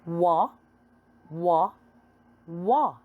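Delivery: "Wa" as a short wa sound; phonics sound. Shown as a demonstration. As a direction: say "wa" as the W sound, not the letter name. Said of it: "This is letter W".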